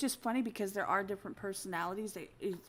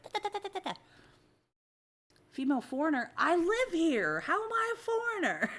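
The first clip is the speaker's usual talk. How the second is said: indignant comeback; 9 semitones higher, 4 dB louder than usual. Pretend. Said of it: "turn around and be like".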